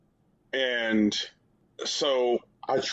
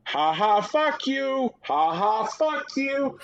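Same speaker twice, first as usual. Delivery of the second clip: very high, taunting imitation of a kid; mock a jeering preteen gamer with a squeaky yell; twelve-year-old voice; 14 semitones higher, slower than usual. Twelve-year-old taunting a teammate on voice chat, high mocking kid voice. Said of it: "in their twelve-year-old voice".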